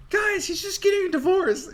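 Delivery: high squeaky mock disbelief; squeaky mock exasperation. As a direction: voice jumps way up in a squeak. Voicing onlookers in retelling.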